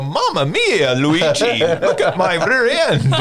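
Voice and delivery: loud, high cartoon-plumber cry; hammed-up exclamation, calling out to a brother; Mario. Mario shows Luigi his swollen butt, loud mock cartoon cry. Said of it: "and he's gonna go".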